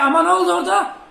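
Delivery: high, loud rising question; startled exclamation. Real, not acted. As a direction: exclaim in alarm at the sudden play. Reacts to sudden play.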